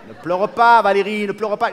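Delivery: loud, pleading plea to stop crying; loud comic plea; pleading don't cry. Acted. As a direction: call out over her sobs, begging. Comforting his crying wife.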